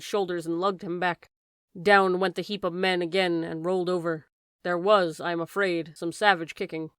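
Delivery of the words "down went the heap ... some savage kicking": plain reading voice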